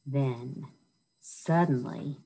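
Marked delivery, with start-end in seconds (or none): none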